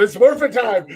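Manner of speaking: put-on high, hammy old lady calling out; older woman voice. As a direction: play a bossy older lady on her porch. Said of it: "Mama Joe's on her porch going".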